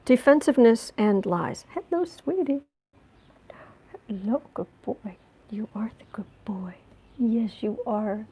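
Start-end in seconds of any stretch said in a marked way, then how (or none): 1.7-2.6 much higher sweet pet voice; high cooing to the cat. Real, not acted. Greeting cat. 4.1-8.3 soft whispered praise; whispering to the cat. Real, not acted.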